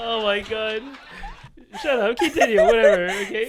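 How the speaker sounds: loud, laughing outburst; laughing loudly. Real, not acted. Laughing fit after sympathy laugh.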